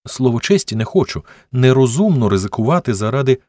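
earnest narrated protest; insistent protest. A narrator's reading. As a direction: keep objecting until cut off. She keeps refusing his offer.

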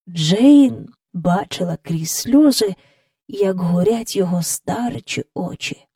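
ordinary delivery

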